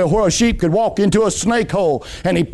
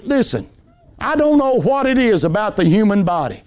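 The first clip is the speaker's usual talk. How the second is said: ordinary talk, nothing marked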